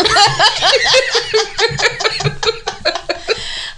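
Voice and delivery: big burst of laughter; loud high laugh. Real, not acted. Laughing after rap.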